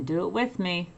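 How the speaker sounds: ordinary delivery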